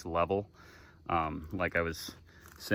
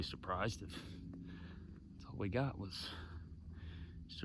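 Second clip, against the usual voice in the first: hushed whisper near the nest; 13 dB quieter, slower than usual. Real, not acted. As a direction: breathe it out, barely voiced, slowly.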